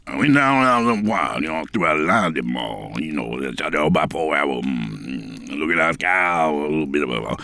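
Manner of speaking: put-on low, slurred mumbling old hermit; mumbling backwoods hermit. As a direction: chew the words like a half-crazy yurt dweller. Mumbling yurt dweller character.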